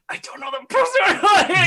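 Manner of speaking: high-pitched, panicked aside; hammed-up alarm, pitch leaping up; mock panic. Adventurer admits ignorance to the others, in high mock panic.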